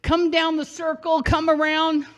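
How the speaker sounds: usual speaking voice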